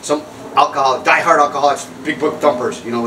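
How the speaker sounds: ordinary talk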